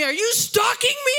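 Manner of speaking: loud, high-pitched mock outrage; imitated angry accusation. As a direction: play the other driver accusing you hotly.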